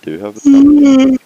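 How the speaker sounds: high, loud held cry; indignant shout. Real, not acted. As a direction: yell it in mock outrage. Indignant outburst.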